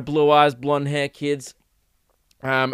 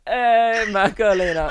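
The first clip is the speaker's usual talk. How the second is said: higher-pitched teasing [name] call; [name] singer; 5 semitones higher, slower than usual. Retold or imitated. Boyfriend teasing girlfriend over her greeting, mock [name] call. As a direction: mimic a party-song shout of hey. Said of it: "You sounded like you were, like, doing the [name]".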